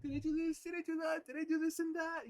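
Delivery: high, loud singsong fretting; acting out jittery second-guessing; jittery self-imitation. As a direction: rattle it off high and jittery, hammed up. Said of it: "getting jittery".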